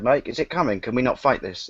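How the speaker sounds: ordinary talk, nothing marked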